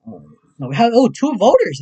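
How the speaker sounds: drops then jumps loud and high; happy surprise. Real, not acted. Streamer noticing two voters, surprised delight. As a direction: react in sudden delight.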